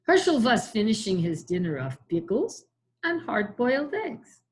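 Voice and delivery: usual speaking voice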